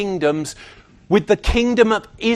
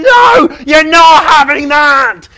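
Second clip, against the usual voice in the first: high, loud, drawn-out mock parental yell; exasperated parent shouting; 6 semitones higher, 10 dB louder, slower than usual. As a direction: yell a refusal at a screaming child. Parent refusing screaming child in Asda.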